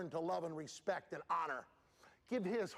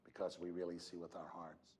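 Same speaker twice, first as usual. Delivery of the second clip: quieter and lower, gentle and sincere; soft, quiet wisdom; 13 semitones lower, 8 dB quieter than usual. Retold or imitated. Helen Keller answering a pitying question, soft and sincere. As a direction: let it land softly, like a wise teacher. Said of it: "And she said".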